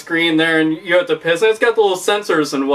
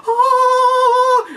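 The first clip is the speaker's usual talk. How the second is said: much higher hammed-up cry of delight; excited yell; 3 dB louder than usual. Drunk guy spotting the game, much higher excited cry. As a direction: squeal like you found the holy grail.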